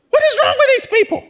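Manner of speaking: high, fast laughing disbelief; incredulous mock. Other side scoffing at believers, high laughing mockery. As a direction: sneer at the other side, squeaky. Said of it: "the people on the other side are like".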